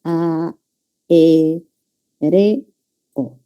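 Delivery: A, E, R, O one by one with pauses; separated syllables. Shown as a demonstration. Teacher dividing aéreo for students, syllable by syllable. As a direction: sound out each piece on its own: A, E, R, O. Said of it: "vamos a separar así".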